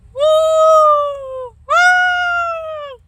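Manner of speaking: long, high, loud howling woo; ghostly baying. A narrator's reading. Girl imitates the scary noise outside, a high drawn-out howl. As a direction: howl like a dog baying at the moon. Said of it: "It sounded like".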